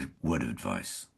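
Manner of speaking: low, hushed retold tip; confiding. Actor quotes co-star's word of advice on set, confiding.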